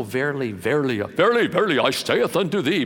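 put-on King James proclamation; mock pompous prophecy. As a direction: parody a grandly solemn prophet. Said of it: "the pomp and circumstances".

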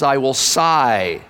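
slow, low, weary voice of God; God sighing. Acted. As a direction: let God's words drag out in regret.

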